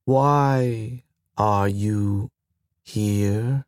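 put-on very slow, wary machine-child query; slow, oddly spaced. Station AI questioning the pilot, slow and halting. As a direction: drag out each word with a small rise. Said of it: "The words were slow and oddly spaced".

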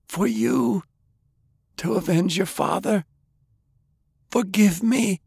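high, halting plea for forgiveness; dying plea. A narrator's reading. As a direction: beg your grandson to understand. Dying grandfather begs forgiveness.

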